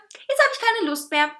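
high, loud diva sulk; snippy, high-pitched brush-off; bitchy refusal. A narrator's reading. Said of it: "zickte er".

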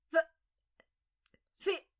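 broken, halting gasp of fear; hoarse frightened whisper. A narrator's reading. Terrified woman recognising her captor, hoarse frightened whisper. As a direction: stammer it out hoarsely in shock. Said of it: "flüsterte sie mit heiserer Stimme".

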